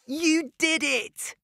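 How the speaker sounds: high, slow joyful cry; excited cry. Acted. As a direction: celebrate the winner. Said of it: "he cried".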